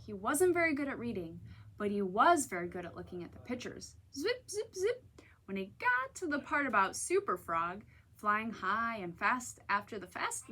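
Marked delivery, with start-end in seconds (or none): 4.1-5.1 zipping sounds. A narrator's reading. Page flipping sounds.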